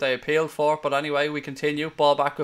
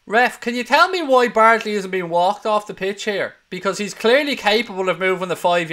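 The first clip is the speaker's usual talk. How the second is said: higher, louder indignant call to the ref; angry demand; 5 semitones higher, 5 dB louder than usual. Real, not acted. Fan demands answer from referee.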